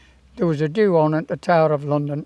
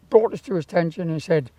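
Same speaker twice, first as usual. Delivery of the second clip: ordinary delivery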